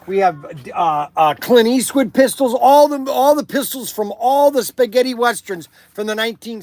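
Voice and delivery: loud, punchy sales pitch. Acted. Shop owner pitching his toy guns in an upbeat ad read. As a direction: sell it like a radio spot.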